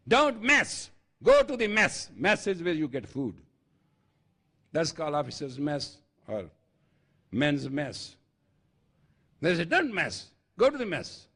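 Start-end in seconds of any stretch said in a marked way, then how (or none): 0.1-2.1 high, loud drill command; army trainer's order. Retold or imitated. Army trainer reprimanding recruits, high loud order. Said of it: "they used to say". 9.6-11.3 army reprimand. Retold or imitated. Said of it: "That was the polite reprimand we used to get".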